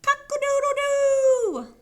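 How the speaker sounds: loud, high, long-held crow; full-voiced cock-a-doodle cry; screaming rooster crow. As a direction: belt out a rooster crow, stretching the last note. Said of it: "And Rooster crowed".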